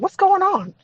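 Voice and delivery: high, startled worry; a sudden alarmed question at the door. Retold or imitated. Girlfriend crying at the door.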